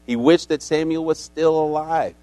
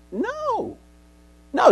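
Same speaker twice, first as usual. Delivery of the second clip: a squeaky high no; mock squeak; 5 dB quieter than usual. Preacher mocking the medium's fake powers, squeaky high no. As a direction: squeak out a no that mocks the idea.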